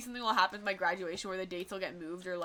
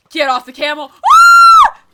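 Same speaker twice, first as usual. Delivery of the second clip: panicked high shout at attackers; being chased; 24 dB louder than usual. Real, not acted. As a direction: yelp as the enemies close in. Attacked in game by husks.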